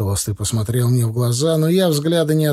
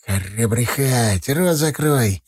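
hissing threat; 2 semitones lower, slower than usual. A narrator's reading. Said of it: "зашипел на меня Шкет".

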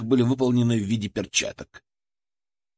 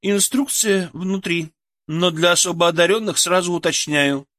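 cold and measured; 6 semitones higher than usual. Acted. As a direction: spell it out coldly to a fool. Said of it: "холодно глядя на взводного, отчеканила она".

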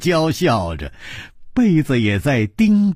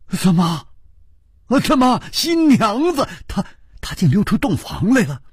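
high-pitched, startled questioning; astonished; 6 semitones higher than usual. Acted. Hero finds the bride out of the bridal chamber, astonished. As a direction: stumble in disbelief, voice jumping up. Hero shocked to see the princess.

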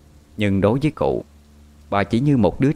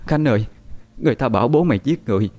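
acted mother's lament, pitched up; tearful wail; 7 semitones higher, faster than usual. A narrator's reading. Mother telling her daughter that father was taken, tearful wail. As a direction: wail the bad news to a daughter. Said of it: "bà Cố lại càng khóc to".

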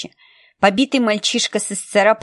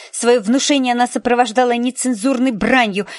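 ordinary delivery

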